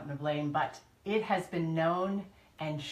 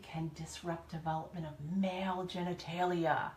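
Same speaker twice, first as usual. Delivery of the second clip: whispering; 2 semitones lower, 7 dB quieter than usual. Real, not acted. Awkward topic, lowered voice.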